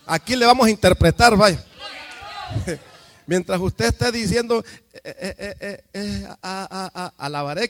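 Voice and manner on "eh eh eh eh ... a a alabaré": imitando tartamudeo. Shown as a demonstration. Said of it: "quiere decir".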